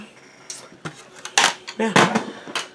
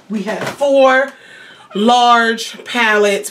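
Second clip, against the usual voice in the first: slow emphasis; 3 semitones higher, much slower than usual. Real, not acted. Drawing out the count.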